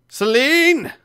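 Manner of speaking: loud high call down the hall. Acted. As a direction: yell the name across the barracks. Calling out to another room.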